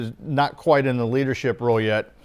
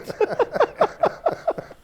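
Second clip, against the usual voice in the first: high burst of laughter; real high laughter. Real, not acted. Laughing at own story.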